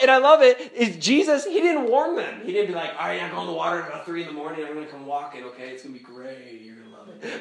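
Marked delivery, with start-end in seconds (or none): 3.0-7.4 quick, low, offhand patter; imitating a breezy, no-big-deal heads-up; mock casual reassurance. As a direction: toss it off, trailing softer and lower. Imagined casual warning from Jesus.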